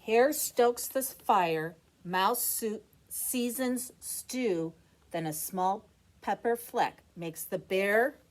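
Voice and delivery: put-on lively sing-song read-aloud for kids; picture book reading. A narrator's reading. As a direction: read it like a bedtime rhyme.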